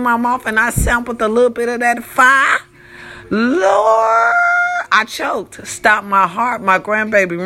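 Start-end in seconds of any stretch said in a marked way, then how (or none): 3.3-4.3 high, wailing exclamation; hammed-up high holler; retold cry of alarm. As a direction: cry out to heaven, mid-story. Retelling her choking on drink.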